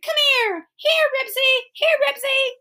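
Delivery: high, pleading dog call; wailing call. A narrator's reading. Henry begs Ribsy to come here, wailing. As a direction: keep calling in a high wail. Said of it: "wailed Henry".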